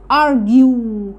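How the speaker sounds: "ارجيو" slowly, ending in a held "yoo"; long YOO. Shown as a demonstration. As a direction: stretch the last syllable of "ارجيو" as "yoo". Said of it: "هنا نطقتها يو". (مد "يو" في آخر "ارجيو")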